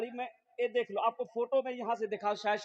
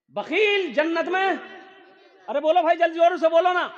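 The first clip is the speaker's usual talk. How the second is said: high, loud prompting; rousing the crowd; 8 semitones higher, 10 dB louder than usual. Real, not acted. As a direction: goad the congregation to answer. Prompting crowd to answer loudly.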